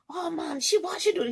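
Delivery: high-pitched Trinidadian accent impression; imitated Trinidadian accent. Trainer complaining about her sister, mock Trinidadian accent. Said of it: "accent. He was from Trinidad".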